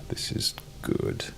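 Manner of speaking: slow whisper; soft slow whisper of approval. Real, not acted. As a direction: breathe it out pleased. Quiet approval while exploring.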